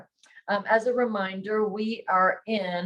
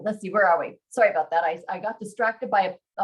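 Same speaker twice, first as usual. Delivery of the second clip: ordinary delivery, nothing marked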